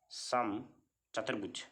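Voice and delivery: समचतुर्भुज split slowly, pause after सम; समचतुर्भुज spaced out slowly for the class; slow dictation. Real, not acted. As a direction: say समचतुर्भुज in two drawn-out parts, सम then चतुर्भुज. Teacher repeats term for class.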